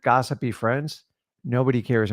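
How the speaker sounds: ordinary delivery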